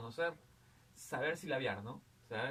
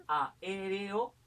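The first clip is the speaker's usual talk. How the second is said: slow, higher "aéreo" split up, with force on É; stress on É; 4 semitones higher, 5 dB louder than usual. Shown as a demonstration. Teacher working out the word type, slow stress on É in "aéreo". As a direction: stretch "aéreo" out and lift the É. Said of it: "antepenúltima".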